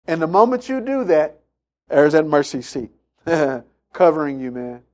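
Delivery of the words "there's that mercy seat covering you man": usual speaking voice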